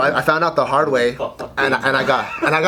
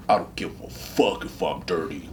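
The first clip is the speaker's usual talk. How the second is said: low, softer tough-guy imitation; manly; 5 semitones lower, 7 dB quieter than usual. Studly recruits shrug off being dirty, mock manly voice. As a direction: play the unbothered jock, pitched down. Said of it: "They just feel so manly".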